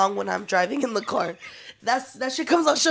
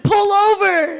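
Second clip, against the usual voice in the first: yelling order; 9 semitones higher, 11 dB louder, slower than usual. Retold or imitated. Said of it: "I was like".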